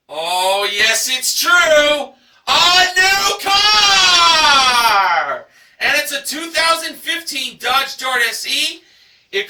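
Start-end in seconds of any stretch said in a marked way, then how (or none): 0.1-5.4 higher, much louder, drawn-out prize call; the announcer revealing the new car; game-show announcer. Acted. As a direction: build up and shout a new car!.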